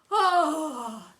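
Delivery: loud high sigh of relief; relieved sigh. Acted. Teacher cooling off in toilet, relieved sigh. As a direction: let out relief as cold water soothes. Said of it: "he sighed".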